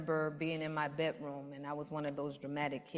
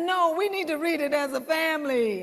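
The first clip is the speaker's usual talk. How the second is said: put-on much higher and louder child plea; pleading child; 11 semitones higher, 9 dB louder than usual. Girl pleading with her grandmother to read Bible, high and loud. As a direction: beg like an earnest kid.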